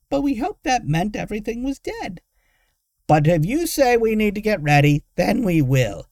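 put-on high old woman's voice, grudging; old woman giving in. A narrator's reading. Old woman agreeing to prepare, grudging. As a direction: give way reluctantly. Tiggy gives in.

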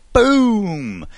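dramatic boom. Acted. Dramatic seed pod explosion.

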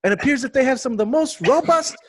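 high, hammed-up Aussie voice reading a review; over-the-top Aussie review read; Australian accent. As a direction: put on a bouncy Australian accent. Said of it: "I want you to do an Australian accent".

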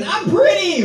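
high, prancing mock voice; mock flamboyant guy. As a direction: toss it off like you're twirling at Pride. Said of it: "he's dancing".

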